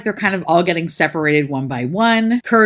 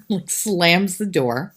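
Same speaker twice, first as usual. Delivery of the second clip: ordinary talk, nothing marked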